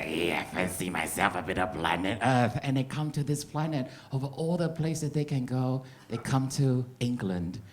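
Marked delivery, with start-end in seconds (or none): 0.0-2.6 cheeky alien. Pretend. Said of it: "cheeky alien race out there saying".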